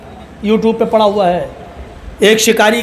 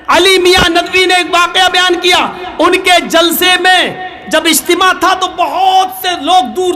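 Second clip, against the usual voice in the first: full-force preaching; 8 semitones higher than usual. Acted. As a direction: rouse the congregation at full volume.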